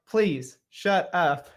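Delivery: loud, emphatic acted plea; exasperated. Barrett silencing the dwarf, exasperated. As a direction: cut the dwarf off with loud, spaced words.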